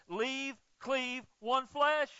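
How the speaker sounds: high, loud pulpit declaration; a loud, high declaration to the church; preaching climax. Acted. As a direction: proclaim it like a verdict. Peak of the argument.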